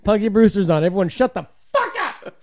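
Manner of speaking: high, loud mock outrage at party guests; yelled hushing. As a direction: yell to silence the room, half laughing. Acts out shushing the party.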